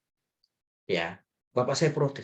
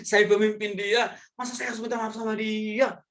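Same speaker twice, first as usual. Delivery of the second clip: indignant boss; 6 semitones higher than usual. Acted. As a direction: balk like a boss too proud to apologise. Acting a boss refusing to apologise. (protes bos gengsi, nada tinggi dibuat-buat)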